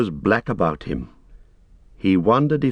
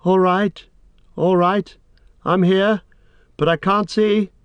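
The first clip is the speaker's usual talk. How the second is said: high, strained call from below; thin-pitched answering cry; Frodo calling weakly; 10 semitones higher, 3 dB louder than usual. A narrator's reading. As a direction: call back up as if shaken and weakened. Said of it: "Frodo was calling with a weak voice".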